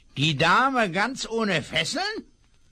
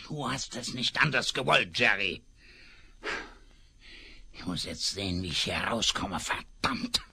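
low, much softer mutter, last word whispered; muttering to himself; 11 semitones lower, 6 dB quieter than usual. Acted. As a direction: grumble it under your breath. Alone, escaping after shooting partner.

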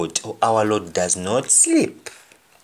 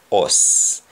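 ordinary delivery

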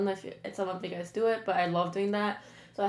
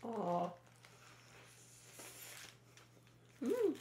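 speaking while chewing sandwich; muffled through mouthful of food; mouth full, chewing; 13 dB quieter than usual. Real, not acted. Seen 1: Bites sandwich, then chews with full cheeks through words.

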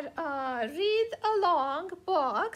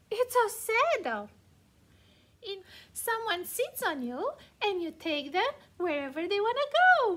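ordinary delivery

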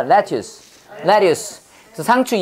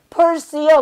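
answering voice; 8 semitones higher, 3 dB louder than usual. Retold or imitated. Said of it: "그랬더니 한 번에".

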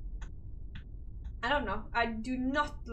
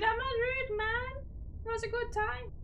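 high defensive protest; 11 semitones higher than usual. Real, not acted. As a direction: voice jumps way up, protesting.

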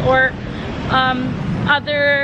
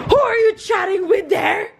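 a raised, hammed-up demand from a pretend jealous wife; mock jealous yell; 5 semitones higher than usual. Wife jokingly confronts husband over his chatting, with a mock jealous yell. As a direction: fake outrage, half-shouted at the husband. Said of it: "Joke lang".

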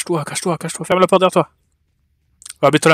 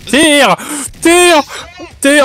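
excited shouting; 8 semitones higher, much slower than usual. Real, not acted. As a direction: shout it at your teammate.

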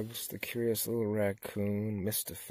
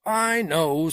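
loud, higher, emphatic frog leader; chief frog; 7 semitones higher, 12 dB louder than usual. A narrator's reading. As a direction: insist loudly, taking charge. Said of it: "said the chief frog".

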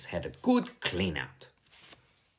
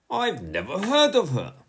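put-on high-pitched boyish retort; indignant kid's comeback; dismissive protest; 10 semitones higher, 4 dB louder than usual. A narrator's reading. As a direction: protest as a small boy who couldn't care less. Bertie dismisses Mum's point.

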